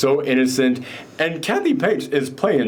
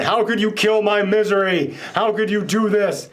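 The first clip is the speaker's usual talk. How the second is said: mock outrage; 6 semitones higher than usual. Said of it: "she loses her mind".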